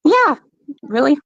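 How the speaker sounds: sudden high yelp of disbelief; really, jumping way up in pitch; surprised disbelief. Real, not acted. A wife hearing what her friend called the interview, surprised.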